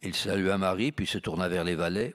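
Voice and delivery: ordinary delivery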